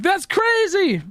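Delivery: very high, slow, squeaky "That's crazy"; stunned. Retold or imitated. Recalling his reaction to her news, squeaky stunned disbelief. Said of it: "I'm like".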